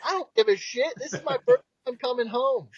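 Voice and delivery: high-pitched mock exasperation; indignant comeback, imitated; retold indignation. Retold argument with visiting family.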